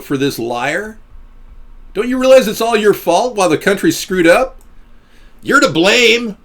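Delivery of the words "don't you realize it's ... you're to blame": higher and louder, blaming the voters; angry rant. Real, not acted. Streamer yelling at chat about the president, angry rant. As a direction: shout the blame at them.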